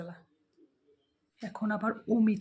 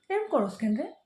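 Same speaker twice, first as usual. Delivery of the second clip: ordinary talk, nothing marked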